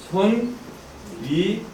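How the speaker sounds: spaced out, rising high then dropping; syllable by syllable. Shown as a demonstration. Teacher showing how a doubled hexagram is read, syllable by syllable. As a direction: give each syllable its own beat. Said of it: "하고 이렇게 읽어요".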